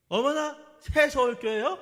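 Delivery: high, loud surprised gasp of recognition; imitated surprise. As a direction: play the delighted shock of a church lady. Elder's wife surprised at meeting.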